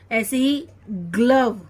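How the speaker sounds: normal talking voice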